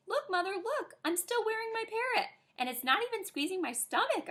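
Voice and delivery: high, eager calling out from the pool; excited calling. Acted. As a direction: call to mom across the water. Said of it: "I shouted".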